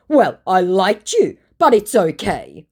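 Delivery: high, loud imitation of wounded suitor; put-on martyr voice; mock hurt. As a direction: play up his self-pity. Mimics his hurt sad-face message.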